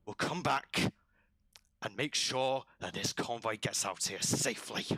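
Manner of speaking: rasping lizard creature giving orders; rough, emphatic command to a guard; lizard commander. Acted. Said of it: "The lizard goes over to one of the guards".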